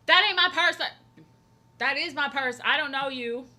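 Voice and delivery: loud, high, defensive shriek of a stranger clutching her purse; mock outraged shout. Streamer imagining strangers in chat, shrieking mock outrage. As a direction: yell it like someone grabbed your bag. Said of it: "I'd be like".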